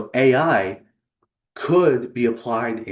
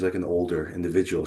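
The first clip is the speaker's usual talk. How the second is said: usual speaking voice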